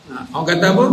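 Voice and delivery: usual speaking voice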